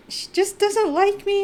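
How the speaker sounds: high, loud retold wail; tearful complaint. Bride complaining to groom about his mother, mock tearful wail. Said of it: "And I start crying".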